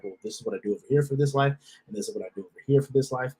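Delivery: a rushed, list-like imitation; quick mock quote. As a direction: rattle it off fast, mimicking. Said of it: "I don't have, like".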